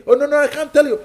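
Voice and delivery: mock alarmed caller, high and loud; mock dramatic refusal. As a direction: overplay the scared caller who won't tell. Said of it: "Some people ring you".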